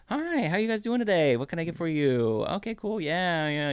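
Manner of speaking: mock waiter greeting a table; chirpy waiter voice. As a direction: put on the forced cheer of a server. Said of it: "you're like".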